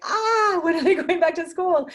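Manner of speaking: hammed-up frazzled parent at the end of their rope; exasperated parent. Coach jokes about parents of young kids, with a shrill laughing mock wail. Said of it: "people with young kids are gonna be like".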